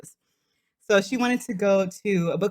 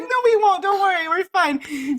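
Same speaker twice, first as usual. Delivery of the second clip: reassuring; 10 semitones higher, 3 dB louder than usual. Retold or imitated. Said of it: "we're like".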